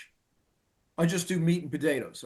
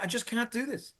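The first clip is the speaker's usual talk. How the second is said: despairing line; 7 semitones higher than usual. Retold or imitated. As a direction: let it drop out like a sigh of giving up. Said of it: "I listed all the stuff".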